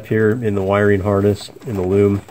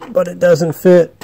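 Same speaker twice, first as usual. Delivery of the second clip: exasperated; 8 semitones higher, 5 dB louder, slower than usual. Real, not acted. Finds the lug won't fit.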